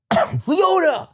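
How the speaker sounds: retold roll-call holler, pitched high; calling out names. Officer calling names off the van, high holler. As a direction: call a name out over a group. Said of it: "he's calling all the names".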